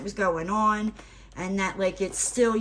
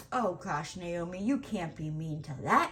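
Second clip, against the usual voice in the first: inner voice; 4 semitones lower, 7 dB quieter than usual. Retold or imitated. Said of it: "quickly in my head".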